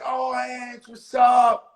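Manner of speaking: loud greeting shout; a cheerful shouted hello across the room. Retold or imitated. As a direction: call out her name loudly, surprised to see her. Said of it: "I say".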